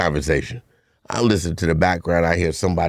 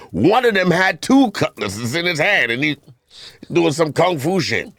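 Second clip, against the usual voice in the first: ordinary talk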